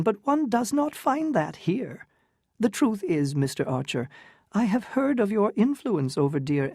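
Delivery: put-on high, affected elderly lady; fluttery old aunt. Medora tells Newland about the Count, high and affected. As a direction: play a gushing society aunt.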